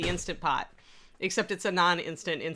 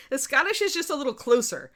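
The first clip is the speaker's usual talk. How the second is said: higher-pitched attempt at a Scottish accent; put-on Scottish accent, pitched up; 7 semitones higher, 4 dB louder than usual. Shown as a demonstration. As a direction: show a tight Scottish accent. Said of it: "hard for me to do a Scottish accent".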